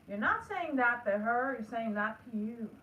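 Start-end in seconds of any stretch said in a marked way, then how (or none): none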